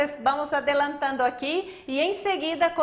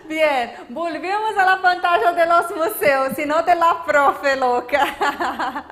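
talking through laughter; amused, laughter running through the words; laughing self-mockery; 4 dB louder than usual. Real, not acted. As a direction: joke at your own expense, still laughing. Joking at herself after the slip.

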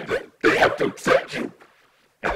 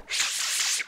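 hushing shh; 10 dB quieter than usual. Acted. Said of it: "hushing the viewers inside video".

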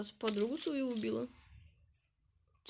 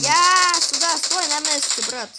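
loud urgent callouts; panicking gamer; 3 semitones higher, 17 dB louder than usual. Real, not acted. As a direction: shout orders mid-fight. Firefight, team calls.

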